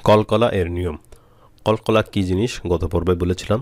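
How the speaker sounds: normal talking voice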